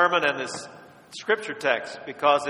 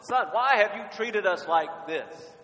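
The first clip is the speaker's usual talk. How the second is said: Mary's reproach; 6 semitones higher, slower than usual. Retold or imitated. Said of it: "that's what Jesus' mom said".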